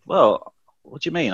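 normal talking voice

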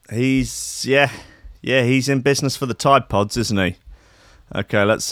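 ordinary talk

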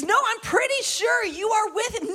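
high-pitched mock accusation; insistent accuser. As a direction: press the accusation in a put-on voice. Intimidating accuser confronts Peter.

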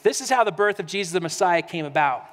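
preacher reading Matthew aloud; scripture reading. Acted. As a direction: read the passage with reverence. Reads scripture passage.

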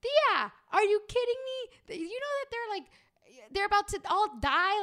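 叔母への驚きの叫び; shocked outcry. Retold or imitated. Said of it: "and I'm like".

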